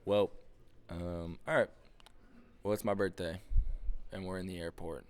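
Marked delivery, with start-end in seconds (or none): none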